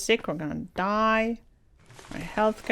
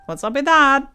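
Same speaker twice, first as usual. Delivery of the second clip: mock complaint; 6 semitones higher, 7 dB louder than usual.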